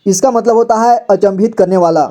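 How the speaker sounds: ordinary delivery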